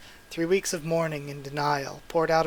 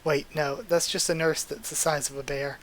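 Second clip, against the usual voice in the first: ordinary delivery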